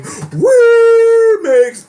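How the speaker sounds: high shrieking scream; screamed remix. Retold or imitated. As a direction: belt it out as a wild scream. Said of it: "expecting me to scream".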